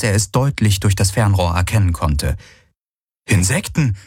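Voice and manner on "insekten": startled exclamation. A narrator's reading. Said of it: "rief er aus".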